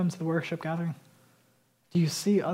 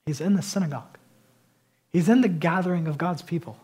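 ordinary delivery

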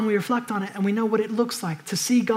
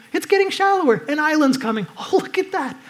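pitched high with excited discovery; light up as you spot the island; a high, thrilled realisation; sudden excitement; 6 semitones higher than usual. Retold or imitated.

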